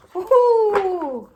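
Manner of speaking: high, loud woohoo; excited whoop. Real, not acted. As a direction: cheer it out joyfully. Celebrating good card.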